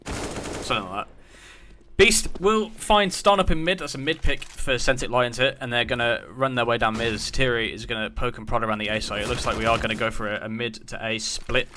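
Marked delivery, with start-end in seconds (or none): 2.0-11.7 live play-by-play. Real, not acted. Caster narrating a mid pick for viewers, live play-by-play. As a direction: call the mid pick as it unfolds.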